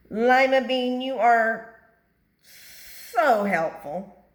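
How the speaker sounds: loud, high, very slow held-back sweetness; forced praise. Acted. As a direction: swallow the snap into a compliment. Turns snap into praise.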